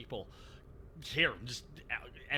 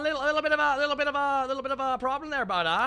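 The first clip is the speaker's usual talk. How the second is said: loud hammed-up Canadian "bud" voice, pitched higher; mock Canadian accent; 7 semitones higher, 5 dB louder, faster than usual. Friends teasing, loud, higher hammed-up Canadian accent. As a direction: lay the Canadian on thick.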